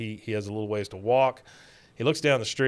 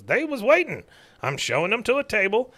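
put-on higher, cranky old codger; old man voice; 9 semitones higher than usual. Cotton defending himself to the manager, higher codger voice. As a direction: play a touchy old veteran.

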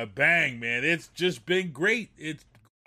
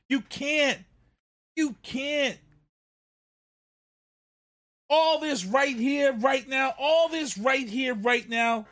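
higher, insistent outburst; heated rant; 5 semitones higher than usual. Real, not acted. Fan furious at the play, heated. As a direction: hammer the point home. Ranting peak.

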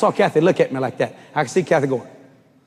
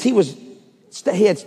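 normal talking voice

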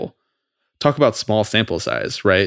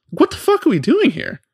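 exasperated outburst. Real, not acted. Ranting at league commissioner.

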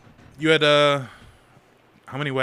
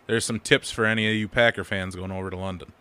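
usual speaking voice

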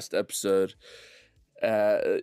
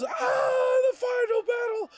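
very high squealing shriek; shrill falsetto character thrill; manic excitement; slower than usual. Acted. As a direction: squeal with unhinged glee. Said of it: "Ragmek takes your arms and he goes".

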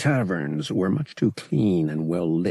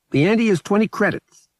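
a higher, put-on gambler's voice, cordial; smooth gambler; 13 semitones higher, 6 dB louder than usual. Lyle inviting Han to the sabacc table, cordial. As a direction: wave him over with easy charm.

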